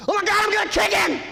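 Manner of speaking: very high, loud enraged scream; furious scream. Retold or imitated. Owner with hurt finger loses self-control at dog, screaming. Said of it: "I scream something like".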